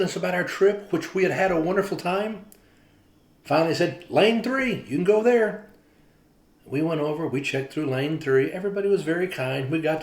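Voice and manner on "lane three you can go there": higher and louder, pointing the way; cheerful greeter. Retold or imitated. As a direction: cheerfully send them off to their lane. Greeter sends them to lane three.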